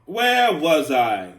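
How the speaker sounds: booming, stretched-out question; loud calling out. Real, not acted. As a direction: belt it out slowly, searching for your place. Loudly calling out for lost place.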